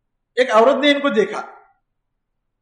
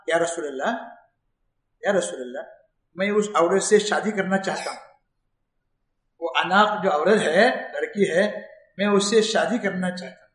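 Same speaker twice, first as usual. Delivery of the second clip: soft plea; 4 semitones lower, 6 dB quieter than usual. Retold or imitated. Companion pleading softly.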